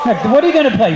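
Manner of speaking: normal talking voice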